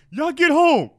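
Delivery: high, booming mock yell; shouted police order. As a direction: bellow it like a cop dispersing a crowd. Acting out police yelling orders.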